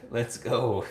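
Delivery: ordinary delivery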